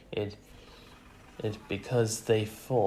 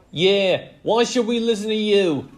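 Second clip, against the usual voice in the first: high, loud retort; angry challenge; 11 semitones higher, 9 dB louder than usual. A narrator's reading. Player challenging the coach, high indignant retort. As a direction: throw it back at him loudly.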